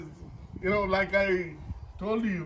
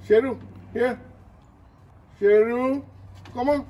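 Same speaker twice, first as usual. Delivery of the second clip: calling the dog; 5 semitones higher, 6 dB louder than usual. Real, not acted. Calling dog across room.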